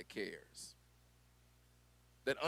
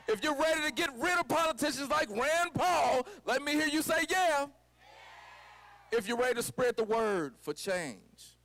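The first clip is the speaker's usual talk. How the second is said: high, loud call to the crowd; rally speech; 12 semitones higher, 6 dB louder than usual. Acted. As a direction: whip the crowd into shouting back.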